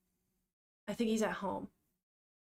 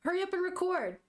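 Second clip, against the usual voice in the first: higher, urgent demanding mother; impatient dying mother; 7 semitones higher than usual. Acted. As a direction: push him to hurry, a bit shrill in pitch only. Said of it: "she goes".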